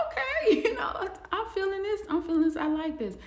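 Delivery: bubbling over with pleased surprise; delighted. Retold or imitated. Man reacting to his handsome date, giddy and high.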